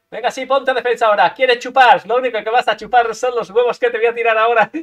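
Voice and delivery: loud, cocky taunting; boastful game banter; loud trash talk. Real, not acted. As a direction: shout the taunt at the rival. Trash-talking opponent, loud.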